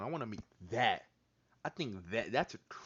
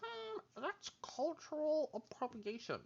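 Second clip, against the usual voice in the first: much higher, slow and prissy, mocking a fake woke girl; slower than usual. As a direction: sneer it like a preachy scold.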